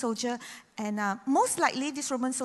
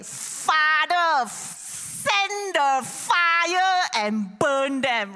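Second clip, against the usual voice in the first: hammed-up angry cry, higher and louder, stretched slowly; mock vengeful outburst; 5 semitones higher, 6 dB louder, much slower than usual. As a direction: pretend to call down fire in a fury. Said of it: "I think if I am on the cross".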